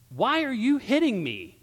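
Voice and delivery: high, loud acted complaint; indignant protest. Beaten donkey suddenly talks to Balaam, high and protesting. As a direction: cry out in wounded protest.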